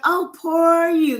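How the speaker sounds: high, drawn-out mock sympathy; mock pity. Manager teasing her for whining about something, mock pity. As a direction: coo it high and slow, pretending to pity. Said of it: "She's like".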